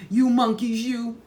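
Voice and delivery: high, loud finger-wagging scold; scolding peddler. A narrator's reading. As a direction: tell off the cheeky monkeys. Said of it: "shaking a finger at them".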